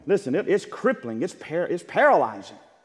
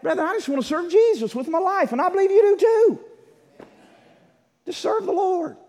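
much higher, pleading preacher voice; impassioned plea; 13 semitones higher than usual. Real, not acted. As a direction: lift the voice high and plead. Pleading with the congregation.

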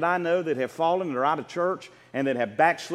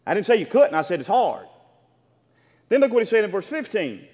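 ordinary talk, nothing marked

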